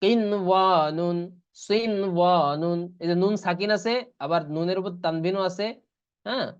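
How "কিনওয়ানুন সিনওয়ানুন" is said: slow, drawn-out tajweed recitation; Quran recitation. Shown as a demonstration. Teacher recites examples to students in slow tajweed. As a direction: chant each word slowly like a Quran reciter. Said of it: "যেমন কি?".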